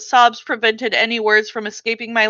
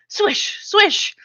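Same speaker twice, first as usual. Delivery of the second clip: high whooshing swish noises, falling; sword swish sounds; 12 semitones higher than usual. A narrator's reading. Girl playing with a stick, voicing sword swishes. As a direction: swoosh the stick through the air.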